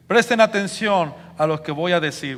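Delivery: loud, high, confident address; authoritative proclamation. Acted. Peter addresses the people of Jerusalem, authoritative proclamation. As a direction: project it boldly to the crowd. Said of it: "a hablar con autoridad, con seguridad".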